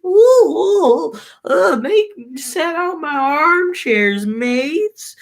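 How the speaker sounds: high, loud hammed-up diva voice; grand lady. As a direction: sweep in like a fussy queen. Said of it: "this Helen girl comes in and she's like".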